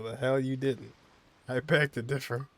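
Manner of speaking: ordinary talk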